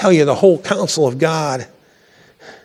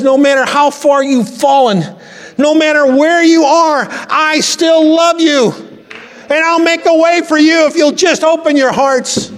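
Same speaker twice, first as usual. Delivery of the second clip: fervent preaching; 11 semitones higher than usual. Real, not acted. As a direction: lift the congregation in full preaching fervour. Voicing God's promise while preaching.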